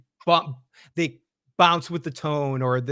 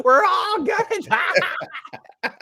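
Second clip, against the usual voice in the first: very high, slow mock wail; mocking Reggie's camera look, very high and slow; hammed-up doom; 14 semitones higher, 3 dB louder, much slower than usual. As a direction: drag out the doom like bad acting. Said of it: "straight looked at the camera and go".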